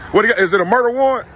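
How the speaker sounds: mock-innocent sarcastic question. Real, not acted. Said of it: "I was being sarcastic".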